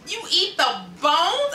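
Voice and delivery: high, slow incredulous question; can't believe it, stretch it up high; shocked disbelief. Retold or imitated. Hearing that people eat chicken bones, high slow disbelief. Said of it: "And I was like".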